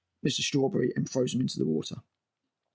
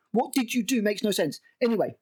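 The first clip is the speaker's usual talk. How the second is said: normal talking voice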